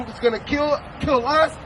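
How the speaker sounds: ordinary delivery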